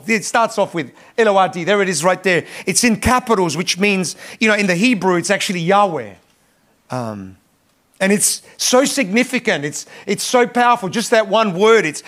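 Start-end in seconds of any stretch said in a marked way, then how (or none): none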